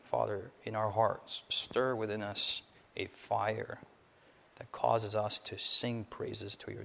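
low, soft prayer; hushed pastoral prayer. Acted. Opening prayer, held low and soft.

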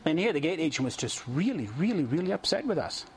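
ordinary talk

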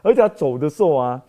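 usual speaking voice

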